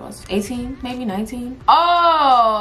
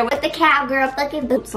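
quoting herself; 4 semitones higher than usual. Retold or imitated. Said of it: "I said".

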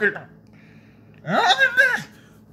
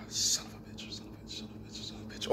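whispered curse, repeated; whispered cursing; 15 dB quieter, faster than usual. Retold or imitated. Seeing his torn toe, a whispered string of curses. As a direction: hiss the curse again and again under the breath. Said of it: "And I'm just like".

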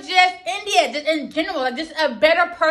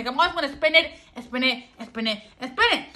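fast, tumbling words; talking too fast; 3 semitones lower, 5 dB quieter, much faster than usual. Real, not acted. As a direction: rush through it without a breath. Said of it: "I'm talking too fast".

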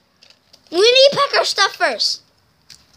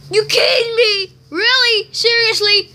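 upset disbelief; 4 semitones higher than usual. Acted. Newscaster upset by bad news.

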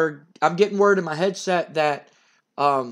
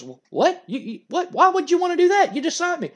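high, fast, stammering disbelief; shocked protest; 11 semitones higher than usual. Retold or imitated. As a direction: sputter in shock, voice jumping up. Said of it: "Jeff Jarrett goes".

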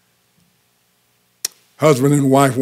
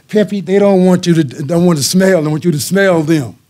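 higher and louder, aimed at the dog; talking loud; 5 semitones higher, 4 dB louder than usual. Retold or imitated. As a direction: say it to the dog but for them to hear. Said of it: "she's talking loud".

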